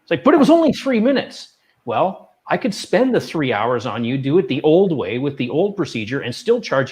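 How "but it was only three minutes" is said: indignant protest. Retold or imitated. Patient protesting the bill.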